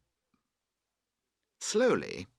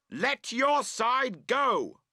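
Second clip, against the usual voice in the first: shouting; 4 dB louder, much slower than usual. A narrator's reading. Tent quarrel, shouted orders.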